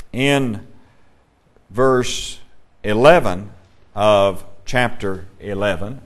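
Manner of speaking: slow, word by word with long pauses; deliberate, halting pace on "verse eleven of chapter eleven"; saying it slowly. Real, not acted. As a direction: space out "in ... verse ... eleven", pausing after each piece. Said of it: "I have to say that slowly".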